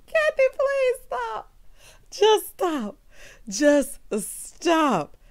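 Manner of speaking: high laugh-strained begging, settling as it goes; helpless with laughter. Real, not acted. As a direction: beg through the tail of a laughing fit. Begging after laughing fit.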